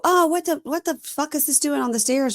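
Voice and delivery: high-pitched mock outburst; mock yelling husband. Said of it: "Why are you yelling at me".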